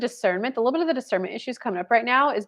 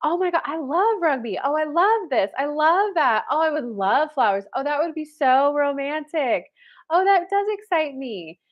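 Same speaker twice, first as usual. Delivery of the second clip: higher, bubbly imitation of gushing; mock enthusiasm; 6 semitones higher than usual. Imitating a younger self gushing to a date, lightly excited. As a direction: play back her eager twenties self. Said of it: "it's this enthusiasm".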